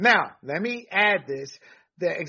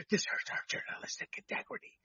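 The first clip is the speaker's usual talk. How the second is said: mock whisper; 15 dB quieter than usual. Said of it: "even some men were like".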